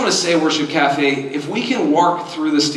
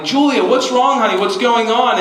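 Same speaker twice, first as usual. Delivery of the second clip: higher, concerned dad calling up; 6 semitones higher than usual. Retold or imitated. Dad calls to daughter crying atop the slide, worried. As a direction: call up to a crying child, mildly alarmed.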